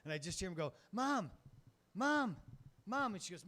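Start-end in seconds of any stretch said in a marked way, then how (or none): 0.9-3.1 put-on much higher child calling out, repeated; kid calling mom. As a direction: call out for mom like a small boy, higher.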